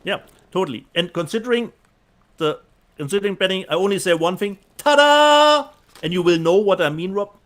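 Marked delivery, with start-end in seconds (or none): 4.8-5.7 high, loud showman's ta-da; triumphant ta-da. Acted. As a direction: flourish it like a magician's reveal. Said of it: "That was beautiful".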